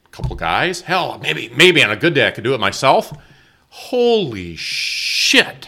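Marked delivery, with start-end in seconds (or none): 3.7-5.7 amazed. Real, not acted. Amazed at heavy table.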